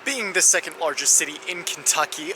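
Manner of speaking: normal talking voice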